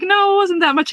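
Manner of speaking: high protesting voice; mock denial. Gabby denying the prank went long, high mock protest. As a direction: squeak out a denial. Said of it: "she's like".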